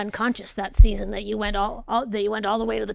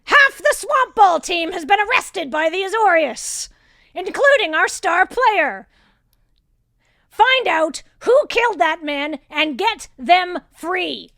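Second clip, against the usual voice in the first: loud, high, commanding boss; shrill yelling; 9 semitones higher, 7 dB louder, slower than usual. Acted. Boss orders her enforcer to free the team, shrill yelling. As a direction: yell the orders shrilly, stretching the final command. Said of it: "when she yells at me".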